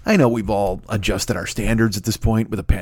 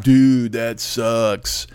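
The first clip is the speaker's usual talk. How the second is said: dude voice; much slower than usual. Acted. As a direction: read it like a bored surfer dude. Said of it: "in that voice".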